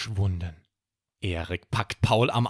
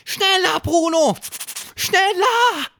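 antreibender Ruf; 7 dB louder than usual. Acted. Ruf an Bruno zur Eile.

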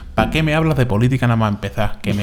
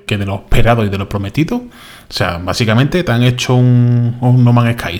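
loud, heated complaining; loud rant; 9 dB louder than usual. Real, not acted. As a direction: build up to an angry outburst. Ranting about broken promises.

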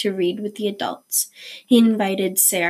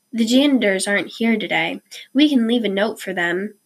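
ordinary delivery, nothing marked

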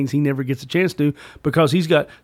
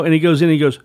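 ordinary talk

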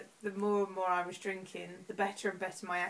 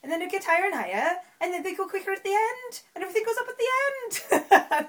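Welsh lilt climbing higher and quicker; Welsh accent; 8 semitones higher, 6 dB louder, faster than usual. Shown as a demonstration. Host demonstrating for a friend, rising Welsh accent. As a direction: push the Welsh singsong up and up to the end. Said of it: "That's my Welsh accent".

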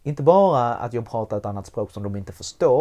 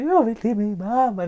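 put-on high, louder child's wail; child screaming; 10 semitones higher, 5 dB louder than usual. Retold daughter screaming for her mother. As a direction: cry out for mama like a distraught five-year-old. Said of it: "Hon skriker".

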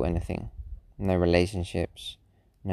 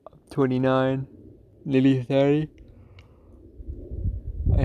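talking through a yawn; words stretched by yawns; 6 semitones higher than usual. Real, not acted. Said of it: "'cause I can't stop yawning".

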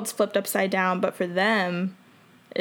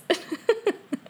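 high giggle; 12 semitones higher than usual. Real, not acted. Laughing at camera angle.

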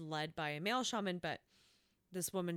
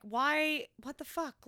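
frustrated; 8 semitones higher, 5 dB louder, slower than usual. Retold or imitated. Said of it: "really frustrated 'cause I'm like".

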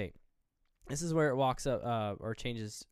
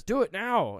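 much higher, louder order; hammed-up doctor's order; urgent command; 9 semitones higher, 10 dB louder, slower than usual. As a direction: demand it right now. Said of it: "he goes".

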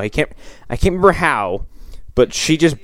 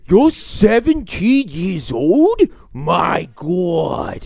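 slow, high, hammed-up disbelief; exaggerated frat guy; 7 semitones higher, much slower than usual. Imitating the guy who learns she's seventeen, exaggerated shock. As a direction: stretch out the shock in a silly high voice. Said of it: "This guy talks just like this".

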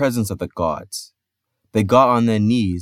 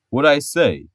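thunderous voice; 6 semitones higher, 4 dB louder than usual. A narrator's reading. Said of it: "in a voice like thunder".

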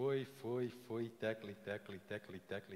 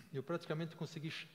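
usual speaking voice

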